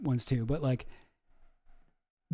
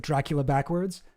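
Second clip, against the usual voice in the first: ordinary talk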